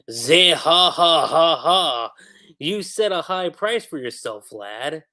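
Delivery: slow, loud cackling pirate boss; drawn-out booming pirate laugh, then the taunt; Blackbeard laugh. Retold or imitated. Mimicking Blackbeard taunting Kobe, a slow loud laugh.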